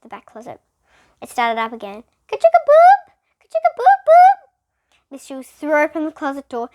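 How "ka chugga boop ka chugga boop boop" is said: very high, loud mechanical bell chant; bell sound. A narrator's reading. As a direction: ring it out like an alarm.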